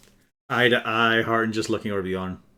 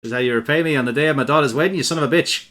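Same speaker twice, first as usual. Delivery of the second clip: loud Boston mob boss, pitched up; Boston gangster accent; 5 semitones higher, 7 dB louder than usual. Retold or imitated. Mocking the GM's line in a loud gangster accent. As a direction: ham it up like a movie godfather. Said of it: "getting a little bit sort of Boston".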